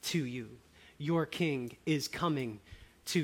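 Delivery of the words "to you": normal talking voice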